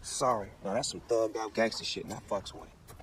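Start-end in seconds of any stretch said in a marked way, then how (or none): 2.0-3.0 half-whispered aside. Real, not acted. Snoop approving the scheme.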